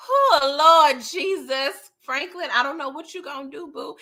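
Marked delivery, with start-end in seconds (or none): 0.1-1.9 a worried cry breaking into a slow Lord Jesus; fretful oh Lord. Real, not acted. Vlogger frets over Franklin's fate, crying out worried. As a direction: worry out loud for him.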